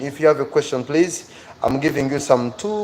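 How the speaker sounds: ordinary delivery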